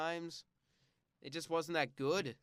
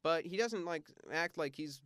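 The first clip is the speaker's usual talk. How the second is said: normal talking voice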